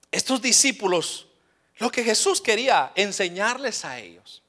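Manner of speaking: ordinary delivery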